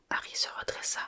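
ordinary delivery

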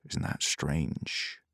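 slow whispered repeat, barely voiced; slow whispered echo; whispered mutter. A narrator's reading. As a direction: breathe it out slowly, almost inaudible. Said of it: "muttered the man".

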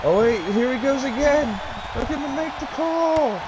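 high, excited play-by-play calls; wrestling commentator. Acted. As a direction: call the big moves like a ringside announcer. Lively match play-by-play.

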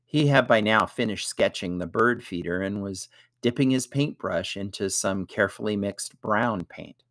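ordinary delivery

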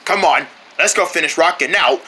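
normal talking voice

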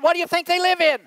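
high, loud comic retort; exasperated retort. Retold or imitated. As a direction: fire back in mock exasperation. Acted retort in domestic argument.

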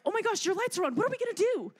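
mock freak-out. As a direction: play a mechanic panicking over warning lights. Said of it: "surprised and freak out".

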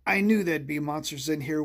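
plain reading voice

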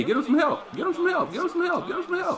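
jumps way up high, same words over and over; mock call for help. Streamer calling for backup in a hockey game, mock high-pitched plea. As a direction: squeak it out, comic and pleading.

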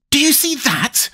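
very high, strained outburst; choking with passion. A narrator's reading. Tweedledum seizing Alice's wrist, choked high outburst. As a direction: squeeze the question out way up high. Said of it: "in a voice choking with passion".

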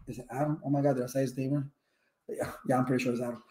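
muttered under the breath, unsure; quiet aside. Real, not acted. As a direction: drop the voice to a private aside. Unsure of a guest's name.

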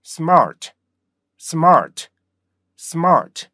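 smart punched high and falling, with r; one strong springy smart; joined English pronunciation. Shown as a demonstration. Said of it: "이렇게 발음하시면 돼요". (탄력 있게 R 살려 smart)

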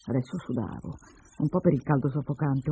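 ordinary delivery

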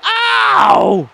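high, loud, pained shouting; crying-out frustrated gamer; outraged yell. Real, not acted. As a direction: yell the question in disbelief. Hurt by partner, yelling.

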